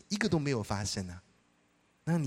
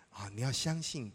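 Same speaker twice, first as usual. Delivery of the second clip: usual speaking voice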